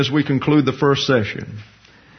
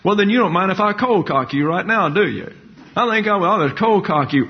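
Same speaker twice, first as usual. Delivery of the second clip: mock tough guy; 6 semitones higher than usual. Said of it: "how these people would react".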